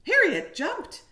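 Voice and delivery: high and loud, acting out the startle; sudden jump. A narrator's reading. Teacher dramatizing the hamster's fright, high and loud. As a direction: make the kids jump with her.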